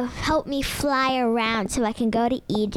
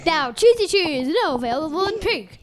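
high, punchy sponsor plug; playful raised advertising voice; mock ad read; 4 semitones higher, 4 dB louder than usual. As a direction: sell it like a silly commercial.

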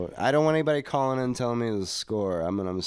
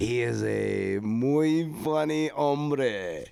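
mock Spanish accent; 4 semitones higher, 4 dB louder, much slower than usual. Mock Spanish echo of quote.

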